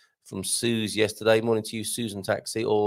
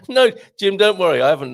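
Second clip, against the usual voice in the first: laughing; 8 semitones higher, 9 dB louder, faster than usual. Real, not acted. Reassuring viewer while laughing.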